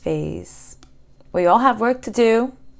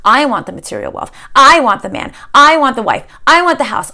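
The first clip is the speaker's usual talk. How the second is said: put-on spoiled child demanding; 5 semitones higher, 7 dB louder than usual. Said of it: "Or are you the other child?".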